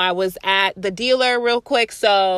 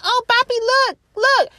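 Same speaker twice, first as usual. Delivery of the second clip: higher, sweet voice talking to her little dog; lady's high voice; 13 semitones higher, 3 dB louder than usual. Retold or imitated. Lady at the neighbor's yard cooing to her small dog, high-pitched. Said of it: "She was like".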